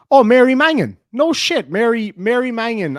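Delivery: sudden high, loud burst of real surprise; surprised recognition. Real, not acted. Seeing a local breeder named in chat, loud surprised outburst. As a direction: blurt the name out, genuinely amazed.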